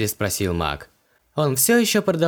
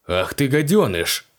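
indignant bark; 2 semitones higher than usual. A narrator's reading. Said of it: "возмущённо рявкнул Мак".

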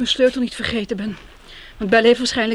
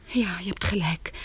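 whisper; 3 semitones lower, 6 dB quieter than usual. Acted. Giving in, still hiding.

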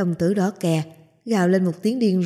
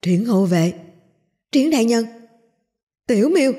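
louder, rising panicked cries; 3 semitones higher, 4 dB louder than usual. Acted. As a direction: cry out in panic. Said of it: "thất thanh kinh hô lên".

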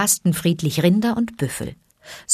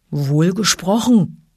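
growling bear; 3 dB louder, slower than usual. A narrator's reading. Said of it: "brummte Baloo".

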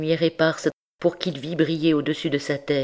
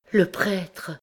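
higher, hushed voice of dread; name him in faint horror, pitched up; faint, terrified gasp; 5 semitones higher than usual. A narrator's reading. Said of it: "dit-elle d'une voix éteinte".

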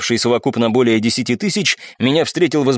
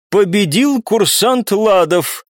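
high, very slow formal verdict; ringing, drawn-out referee's declaration; judge's announcement; 7 semitones higher, much slower than usual. A narrator's reading. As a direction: proclaim the winner, stretching each word. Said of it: "прозвучал голос судьи".

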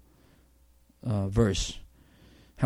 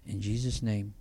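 prayer; 3 semitones lower than usual. Acted. Closing prayer.

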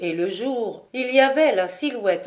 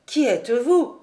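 louder, pressing question flung at the stranger; urgent demand; 3 semitones higher, 6 dB louder than usual. A narrator's reading. Jude begging the charcoal burner to reveal himself, loud and urgent. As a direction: demand an answer in God's name.

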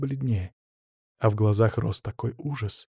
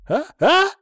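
high startled gasps; gasping; 4 dB louder than usual. Acted. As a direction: gasp it out in shock. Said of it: "ахнул Адамыч".

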